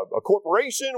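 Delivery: ordinary delivery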